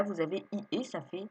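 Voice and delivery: ordinary talk, nothing marked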